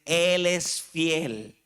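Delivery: Él es fiel spoken very slowly, each word weighted; slow stressed reading. Shown as a demonstration. Preacher reads the verse slowly to the church, each word weighted. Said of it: "Primera de Juan uno nueve dice".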